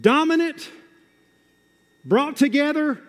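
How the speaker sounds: high, driving sermon delivery; full-force preaching. Real, not acted. Preacher rallying the church to take the offense, forceful preaching.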